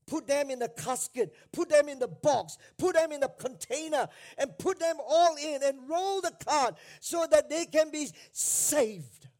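sermon crescendo from firm to loud; rising preaching fervor. Acted. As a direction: build it up line by line, pressing harder.

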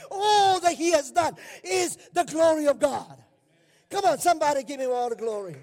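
loud, rising preacher's call to the church; full-force preaching. Real, not acted.

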